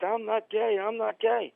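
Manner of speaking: ordinary talk, nothing marked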